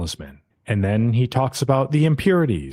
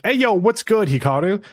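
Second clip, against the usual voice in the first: cocky casual greeting; 9 semitones higher than usual. Retold or imitated. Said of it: "and he's like".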